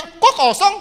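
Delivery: high, loud, puzzled question; baffled surprise. Acted. Preacher acts out opening his empty phone gallery, baffled.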